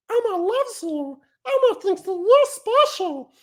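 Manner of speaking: very high-pitched puppet falsetto; Elmo. Shown as a demonstration. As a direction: do a squeaky little puppet monster impression. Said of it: "I can do a good Elmo".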